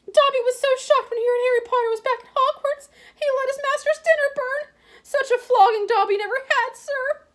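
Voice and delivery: high, squeaky, loud elf voice near tears; tearful house elf. A narrator's reading. House elf reproaching Harry, squeaky and tearful. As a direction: plead like a weeping servant elf.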